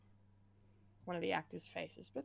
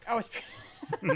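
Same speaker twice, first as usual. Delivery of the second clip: words lost in a laugh; 3 semitones higher, 8 dB louder, slower than usual. Real, not acted. Host cracking up at co-host's joke, laughing. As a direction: crack up mid-word.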